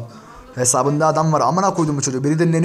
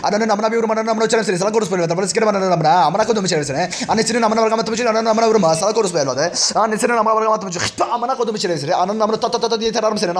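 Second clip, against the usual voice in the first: higher-pitched, relentless stream of swearing; angry tirade; 6 semitones higher than usual. Real, not acted. Streamer cursing out an absent rival, angry high-pitched tirade. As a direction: rant at him in a raised pitch without letting up. Sudden rapid-fire abusive rant at absent rival.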